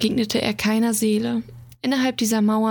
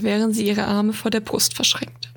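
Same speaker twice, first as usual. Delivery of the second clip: ordinary delivery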